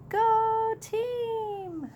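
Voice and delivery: high excited cheer. Acted. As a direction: cheer the team on. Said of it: "she said".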